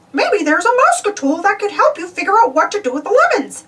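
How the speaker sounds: high, bright cartoon mouse voice; cheerful helpful mouse voice; Mickey voice. Mickey suggests a Mouseketool to Donald, high cheerful voice. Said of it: "suggested Mickey".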